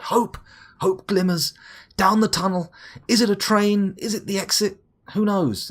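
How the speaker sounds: high, loud, theatrical hope; dramatic inner voice. Pretend. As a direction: proclaim it like an epic trailer. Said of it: "I thought".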